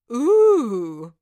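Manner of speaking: loud, amazed cry of ooh; young lord's loud ooh; delighted ooh. Acted. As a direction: gasp out an excited ooh. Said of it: "cried out a young lord".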